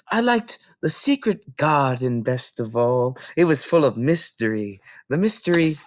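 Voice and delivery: put-on little girl voice. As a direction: dwell on the mystery like a rapt little girl. Matilda describes favourite book.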